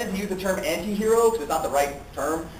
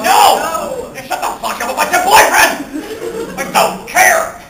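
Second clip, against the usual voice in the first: high, loud comic outburst; mock outrage; 12 semitones higher, 6 dB louder than usual. Single person sick of hearing about someone's boyfriend, mock outrage. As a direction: yell it as an exasperated rant.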